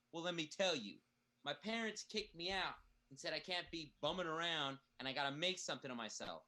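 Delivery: ordinary talk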